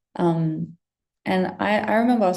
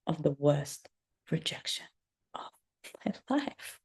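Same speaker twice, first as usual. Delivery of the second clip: ominous whisper; 4 semitones lower, 9 dB quieter than usual. Acted. Ominous story teaser.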